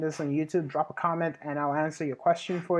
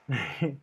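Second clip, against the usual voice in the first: short laugh; 4 semitones lower, 6 dB quieter than usual. Real, not acted. Joking plug for own service.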